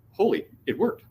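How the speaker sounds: high retold amazement; amazed relief. Tim after the CEO meeting, amazed relief. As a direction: pitch up in mild disbelief.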